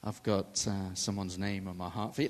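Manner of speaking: a hint of a clumsy stranger; mild mock low greeting; awkward mock greeting. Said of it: "If I go up to her and go".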